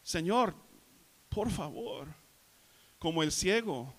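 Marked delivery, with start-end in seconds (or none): 0.0-2.1 higher, pleading humble appeal; humble plea. Shown as a demonstration. Preacher shows how to come to Jesus, humbly. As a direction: appeal humbly, as one begging a favour. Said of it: "hay que venir con Jesús con humildad".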